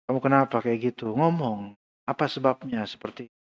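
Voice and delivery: quiet, low, caring question; soft heart to heart. Shown as a demonstration. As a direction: ask gently, almost under the breath. Heart to heart demo.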